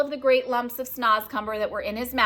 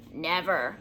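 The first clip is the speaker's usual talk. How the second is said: usual speaking voice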